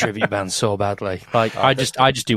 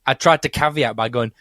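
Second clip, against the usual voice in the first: ordinary delivery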